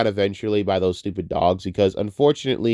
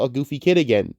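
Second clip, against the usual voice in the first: ordinary delivery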